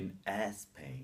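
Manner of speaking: SPAIN said as "eh-Spain"; an added vowel before the S of Spain; wrong extra E. Shown as a demonstration.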